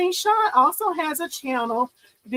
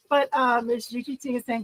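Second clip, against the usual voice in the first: usual speaking voice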